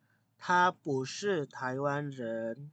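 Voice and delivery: very slow, syllable by syllable Mandarin; very slow model sentence. Shown as a demonstration. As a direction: spell out each syllable slowly. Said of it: "Dia bukan orang Indonesia". (sangat pelan per suku kata)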